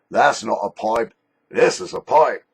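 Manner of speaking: mock boast. Showing off a new pipe to viewers, loud mock boast. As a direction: punch it out like a showdown line. Acting out a boastful movie-style line.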